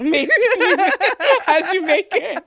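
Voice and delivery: laughing through the dad's words; quoting him while cracking up; laughing quote. Retold or imitated. Guest laughing as she quotes her late dad. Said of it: "he said".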